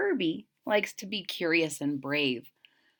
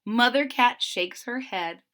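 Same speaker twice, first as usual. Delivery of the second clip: ordinary delivery, nothing marked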